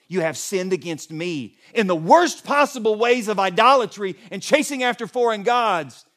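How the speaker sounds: loud, high declaration; God's indictment preached at full force; forceful preaching. Acted. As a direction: thunder it out to the people.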